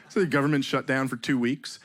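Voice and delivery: usual speaking voice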